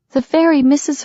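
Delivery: put-on high child voice, earnest; small girl stating a fact; little girl voice. A narrator's reading.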